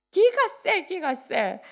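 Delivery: high, loud burst; loud exclamation. Acted. Pastor to the congregation, loudly exclaiming. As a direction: shout it out with a laugh. Exclaiming father's strong temper.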